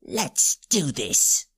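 sudden steely hero; deadly determined. Acted. Seymour, steeling himself for the fight, low and grim. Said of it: "a look of deadly determination".

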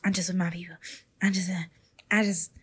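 low, soft, halting mumble; mock standoffish traveler. Mocking people who won't meet anyone on trips, low halting voice. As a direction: play someone timidly keeping to their own group. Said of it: "just be like".

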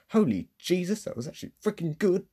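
high, fast, amazed outburst; excited. Retold or imitated. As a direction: burst out thrilled. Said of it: "I went".

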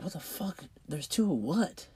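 high-pitched, incredulous questioning; baffled. Retold or imitated. Dad across the clinic to girlfriend, baffled. Retold confusion at mouthed news.

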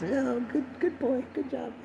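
high, soft mom impression; quietly doting mock mother; proud mom. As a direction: praise softly in a falsetto mom voice. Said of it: "My mom was so proud".

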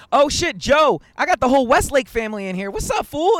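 loud, high-pitched hello to the homies; excited greeting. Real, not acted. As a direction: call out to friends who just showed up. Greets friends joining the live chat.

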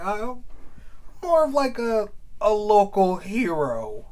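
high, slow, hammed-up modesty; lightly mocking self-important pose; mock humble celebrity. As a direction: drag it out like a fake-humble star. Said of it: "I was like".

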